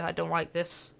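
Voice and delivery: ordinary delivery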